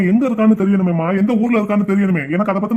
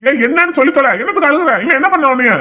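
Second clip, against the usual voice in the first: higher-pitched, impatient questioning; angry demand; 5 semitones higher than usual. Acted. Brother demanding why his sister is crying, angry. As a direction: press her sharply for an answer.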